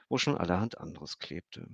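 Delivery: ordinary delivery, nothing marked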